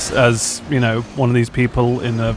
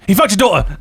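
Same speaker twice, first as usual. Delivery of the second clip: mild mock yell, raised pitch; retold scream; 6 semitones higher than usual. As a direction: imitate a blurted shout without full volume. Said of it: "screamed into the microphone".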